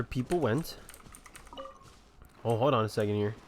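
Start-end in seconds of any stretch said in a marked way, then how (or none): none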